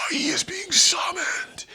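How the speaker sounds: high, slow whisper; hushed like a ritual announcement; mock mystical whisper. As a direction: Michelle Obama announcing Barack in awe. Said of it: "she was like".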